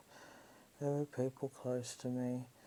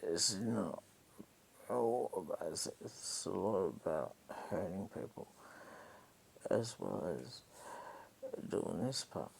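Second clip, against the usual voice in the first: lower, flat menacing mock of him; low, lightly voiced hint of him; imitating him; 6 semitones lower than usual. Quoting his threat about hurting people, in a lowered mock voice. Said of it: "he's like".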